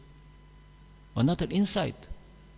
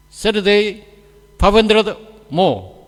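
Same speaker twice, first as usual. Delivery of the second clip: louder, clipped instruction; terse order; 6 semitones higher, 11 dB louder than usual. Retold or imitated. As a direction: give the cook a blunt short order. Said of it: "how you communicate?".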